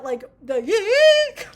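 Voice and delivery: imitated high yelp. Imitating the singer's high note.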